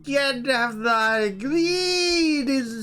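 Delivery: high, slow, wailing preacher; mock drunk vicar. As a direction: preach greed like a tipsy vicar.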